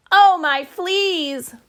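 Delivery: high, drawn-out motherly wail; worried mama's slow, high wail. Acted. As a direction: cry it out in storybook alarm. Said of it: "wailed Mama Junebug Jones".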